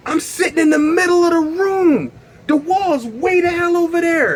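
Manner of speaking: very high, freaked-out squeal; panicked alarm. Acted. Tripping man stranded mid-room, high panicked squeal.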